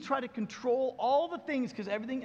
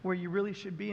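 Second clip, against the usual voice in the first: gentle God speaking; 4 semitones lower, 5 dB quieter than usual. Retold or imitated. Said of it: "And God says".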